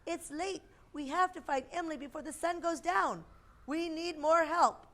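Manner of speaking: high, worried mother's voice; anxious pleading to find her; frantic mother. A narrator's reading. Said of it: "Emily's mother was getting frantic".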